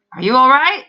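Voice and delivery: loud call through the window; shouted. A narrator's reading. Guards shouting through window to the soldier. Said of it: "tapped on the window and shouted".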